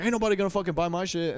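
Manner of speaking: high, fast mocking of the guy; mock drunk guy. Quoting the rude guy at the bar, high and fast. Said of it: "he was like".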